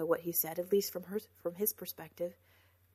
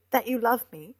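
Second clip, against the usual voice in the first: louder, higher plea for love; urgent, raised appeal; insistent plea; 4 semitones higher, 11 dB louder than usual. A narrator's reading. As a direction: press the point with feeling. Pleading for his love.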